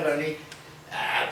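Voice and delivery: ordinary delivery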